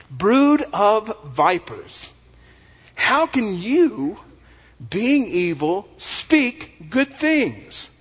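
slow, high-pitched thundering rebuke; laying into them. Acted. Jesus rebuking the religious leaders as vipers, laying into them. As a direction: denounce them, stretching every word out. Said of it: "he began to really lay into them".

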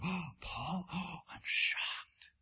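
slow, hushed, mock-shocked whisper. Corinthians reacting to Paul's letter, mock-shocked whisper. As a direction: gasp it out as a scandalized church member. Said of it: "And they go".

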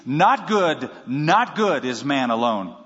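"not good" set first and stressed, slowing; "not good is man alone" said slowly, with "not" hit hard; emphatic not good. Shown as a demonstration. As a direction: hammer "Not good" at the front. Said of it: "So it would literally read".

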